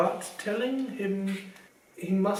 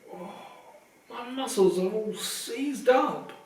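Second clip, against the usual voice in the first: groaning; 5 semitones higher than usual. Acted. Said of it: "he groaned".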